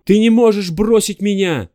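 loud high desperate shout. Acted. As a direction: yell it in despair. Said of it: "закричал он".

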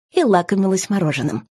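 ordinary delivery, nothing marked

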